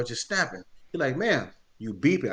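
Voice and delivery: ordinary talk, nothing marked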